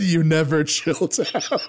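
high mock-exasperated mother, breaking into laughter; mom's retort. Host quoting mother about childhood, mock exasperated. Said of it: "And she said".

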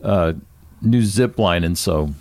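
ordinary talk, nothing marked

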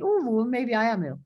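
high, musing mock realisation; dawning self-doubt. Person told they are ill, musing self-doubt.

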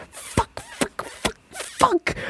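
airy, strained squeak of panic; strangled panicked whisper. Acted. Alone in the bathroom, panicking: strangled whispered curses. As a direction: choke out curses in a tight, breathless panic.

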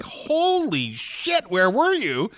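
very high, drawn-out amazed cry; group shock. Retold or imitated. Everyone on the bus greeting the missing guy, stretched high amazement. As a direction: stretch holy shit way up in mock astonishment. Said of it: "everyone is like".

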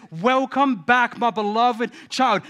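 father welcoming home the prodigal son, slow and tender; tender welcome. Retold or imitated. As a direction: draw out the words like a father embracing a lost son. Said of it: "and says".

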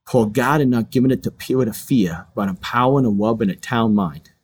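imitated toddler lisp, consonants softened and swapped; child's lisp. Little son reciting memorized verse to dad, childlike lisp. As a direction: recite it like a small boy with a speech impediment. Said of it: "he had a little bit of a lisp".

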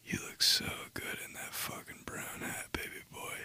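breathy come-on whisper, very soft; hushed sultry murmur to a customer; seductive whisper. Retold or imitated. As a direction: whisper it like a flirting cashier. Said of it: "she said it in, like, a tone".

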